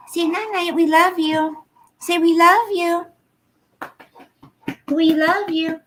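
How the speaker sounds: sing-song and high; pet-coaxing voice. Real, not acted. Prompting pet to greet viewers.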